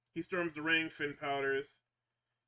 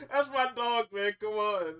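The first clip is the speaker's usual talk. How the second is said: laughing; 4 semitones higher, 5 dB louder than usual. Real, not acted. Laughing delight at Punk.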